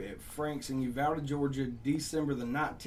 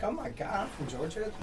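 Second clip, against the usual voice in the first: high-pitched start, lightly imitated girl; a hint of a gushing girl; 6 semitones higher, 3 dB quieter than usual. Girl finds a fellow Georgian, lightly imitated gushing. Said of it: "she's like".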